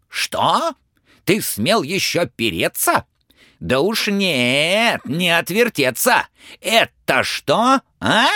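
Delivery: high, stern, bossy tsar voice; a wrathful old tsar barking orders; angry tsar. Acted. Tsar interrogating Ivan about the firebird feather, angry.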